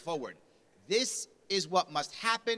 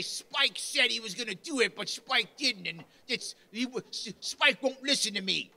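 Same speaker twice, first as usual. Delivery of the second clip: higher and louder, blaming someone else; whiny excuse; 5 semitones higher, 4 dB louder than usual. Retold or imitated. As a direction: pass the buck, whining high. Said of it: "He'll say".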